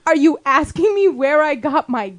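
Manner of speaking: slow, loud disbelieving question; mock incredulity. Imitating his retort to the dump worker, mock incredulous. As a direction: stretch it out, incredulous, into a laugh.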